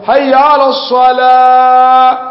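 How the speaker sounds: حي على الصلاة chanted slowly with the word changed; wrong ending. Shown as a demonstration. Teacher demonstrating the mistaken call for contrast, slow chant. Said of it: "أما قلت".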